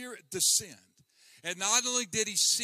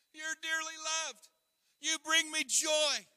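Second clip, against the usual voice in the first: tender blessing; 10 semitones higher than usual. Acted. Voicing the Father's blessing.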